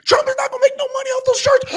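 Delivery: high, fast gasping panic; mock hysterical fan. Mocking people who think Trump won't profit from shirts, in a high squeal. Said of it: "Don't be like".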